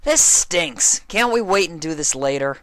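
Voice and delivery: whining complaint. Acted. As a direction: complain loudly, squirming. Dash strapped, complaining.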